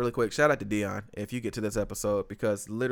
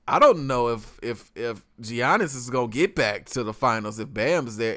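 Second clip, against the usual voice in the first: ordinary delivery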